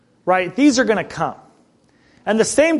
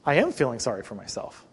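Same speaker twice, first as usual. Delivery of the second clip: quiet self-pity; 6 semitones lower, 8 dB quieter than usual. Retold or imitated. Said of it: "And I'm like".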